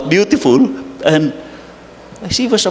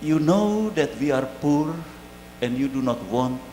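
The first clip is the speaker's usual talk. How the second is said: tender words to mother; 4 semitones lower, 13 dB quieter than usual. Real, not acted. Priest speaking to dead mother.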